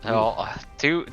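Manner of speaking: ordinary talk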